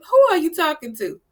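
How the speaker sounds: indignant challenge. Retold or imitated. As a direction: retell it as an offended challenge. Confronting the rude rep, retold.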